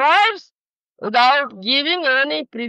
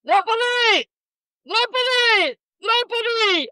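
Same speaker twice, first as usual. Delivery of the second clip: high calling shout of "Draupadi!", pitched much higher; shouting a name; 12 semitones higher than usual. Retold or imitated. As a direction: call "Draupadi!" across the house, high and urgent.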